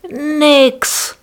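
high, loud call. A narrator's reading. Dax shouting the magic word to the suitcase, loud high call. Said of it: "rief Dax".

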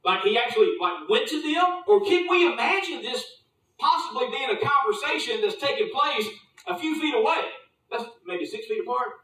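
ordinary talk

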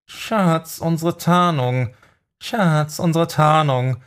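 hoch, gepresst und keuchend gesprochen; keuchend sticheln. A narrator's reading. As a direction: unter Schmerz stichelnd nach Luft ringen. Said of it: "stichelte der Magier keuchend". (keuchend und stichelnd)

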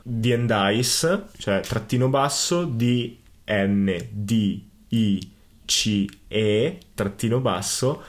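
slow letter-by-letter spelling; dictating. Shown as a demonstration. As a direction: spell out D N D I C E slowly. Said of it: "lo ripeto".

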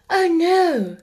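high, loud, startled refusal; well-bred little girl, taken aback. A narrator's reading. Girl refusing a boy's sixpence, startled and polite.

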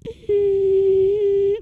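very high thin imitated ringing "Ee"; ear ringing noise. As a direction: mimic the whine of ringing ears. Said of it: "that noise you get in your ear".

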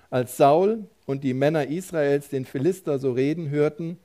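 usual speaking voice

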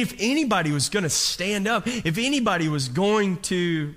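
normal talking voice

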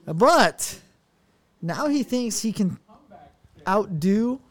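usual speaking voice

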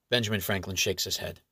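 usual speaking voice

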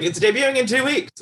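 high-pitched, sudden mock announcement. Retelling a surprise release date, mock announcement. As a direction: sing it out high, as breaking news.

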